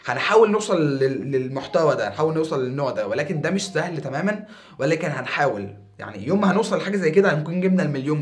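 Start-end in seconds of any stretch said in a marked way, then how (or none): none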